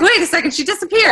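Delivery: high mock surprise; wait, where did she go; surprised. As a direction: pretend alarm at a vanished face. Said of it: "I was like".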